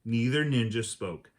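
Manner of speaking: plain reading voice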